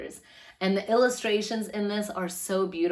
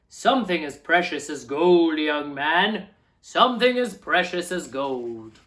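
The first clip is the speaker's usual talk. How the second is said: a hint of a booming giant; lightly voiced giant; 9 dB louder, slower than usual. Giant-voiced man answers.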